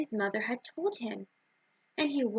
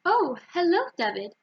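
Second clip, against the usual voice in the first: a light chuckle in a higher, louder hello; chuckling greeting; 6 semitones higher, 5 dB louder than usual. A narrator's reading. Challah lady greeting David with a light chuckle. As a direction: greet him brightly with a hint of a chuckle. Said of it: "chuckled Chaya the challah lady".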